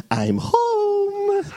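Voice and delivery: high, drawn-out sing-out call; Jan. Retold or imitated. Preacher imitating the neighbour's parrot calling at the front door, high mimicry. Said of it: "learned how to perfectly mimic Jan's voice".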